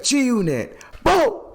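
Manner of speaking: high, loud rap ad-lib; imitated hype shout. Rapper's crew call on a track, imitated hype shout. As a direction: shout it high, building to a gunshot noise.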